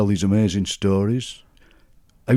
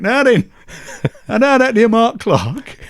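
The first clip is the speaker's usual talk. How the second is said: much higher, louder Sheffield uncle; Uncle Jack voice; 12 semitones higher, 6 dB louder, slower than usual. Retold or imitated. Uncle offering the trick jam tart, high Sheffield voice. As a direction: ham up the joking uncle. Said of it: "my Uncle Jack went".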